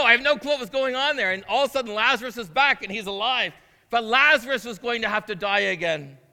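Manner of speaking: normal talking voice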